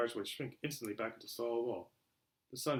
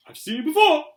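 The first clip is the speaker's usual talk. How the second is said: put-on high, loud young voice; excited boy; eager boy; 15 dB louder than usual. A narrator's reading. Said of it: "said Harry".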